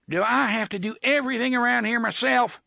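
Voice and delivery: the boss snaps at his crew; exasperated shout. Acted. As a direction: throw it out fed up. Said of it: "TikTok shouted, more exasperated than angry".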